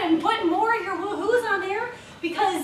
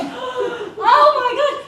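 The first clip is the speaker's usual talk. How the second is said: shocked gasp; 7 semitones higher, 6 dB louder, much slower than usual. Retold or imitated. Gasping realization retold.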